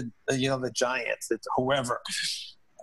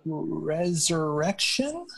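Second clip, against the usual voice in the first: slow, sheepish, questioning guess; a player sheepishly guessing for the coach; tentative guess; 3 semitones higher, much slower than usual. Retold or imitated. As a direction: offer the word unsure, dragging it out. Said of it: "very, like, tentatively".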